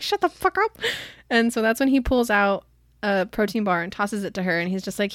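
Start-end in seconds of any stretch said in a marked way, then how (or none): 0.0-0.8 high-pitched playful "shut up" comeback; mock snappy retort. Olive snaps back at Adam's teasing, high and playful. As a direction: bat away the teasing, pitched way up.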